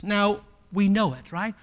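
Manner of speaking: usual speaking voice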